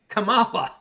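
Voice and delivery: Kamala with the middle syllable stressed, kuh-MAH-la; her own pronunciation. Retold or imitated. Host citing videos of her, Kamala as kuh-MAH-la. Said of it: "videos of her pronouncing it".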